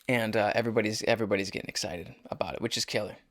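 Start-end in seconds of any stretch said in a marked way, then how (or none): none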